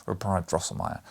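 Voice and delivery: ordinary delivery, nothing marked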